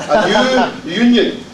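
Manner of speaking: "new union" drawn out at half speed; slow, deliberate. Shown as a demonstration. Speaker jokes that Glaswegians struggle with vowels, slow and careful. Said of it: "I need to say this slowly".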